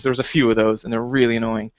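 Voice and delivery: usual speaking voice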